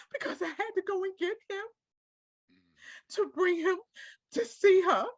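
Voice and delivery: fighting through sobs as the memory hits; tearful grief, voice cracking upward; crying. Real, not acted. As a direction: voice breaking high into real tears. Breaking down recalling her mother's death.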